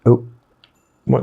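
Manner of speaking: ordinary talk, nothing marked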